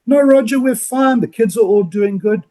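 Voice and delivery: lightly acted, upbeat reassurance; friendly phone reply. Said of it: "enjoy what they give back to you".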